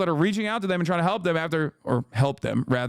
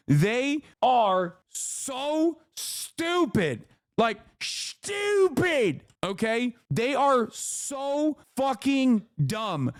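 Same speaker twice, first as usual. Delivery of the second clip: exasperated; 6 semitones higher, much slower than usual. Real, not acted. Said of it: "exasperated emphasis on stupidity".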